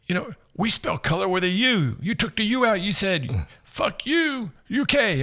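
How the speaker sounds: a hint of a gleeful Englishman; imitating the British guy. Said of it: "he goes".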